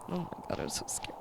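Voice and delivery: soft frightened whisper; scared whisper. Real, not acted. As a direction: whisper it, terrified. Frightened during horror game.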